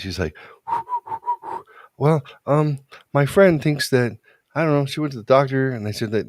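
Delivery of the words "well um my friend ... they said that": nervous daughter. Retold or imitated. Nervous daughter tells dad.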